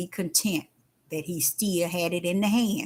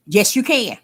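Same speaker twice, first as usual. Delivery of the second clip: normal talking voice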